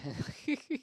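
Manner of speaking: high, quiet giggle; laugh. Real, not acted. Comic after retelling the host's jab, soft high giggle.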